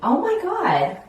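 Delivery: high astonished exclamation; surprised. Real, not acted. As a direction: gasp it out in amazement. Seeing result of haircut.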